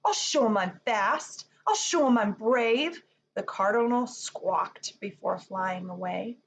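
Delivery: normal talking voice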